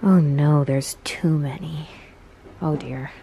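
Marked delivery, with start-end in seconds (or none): none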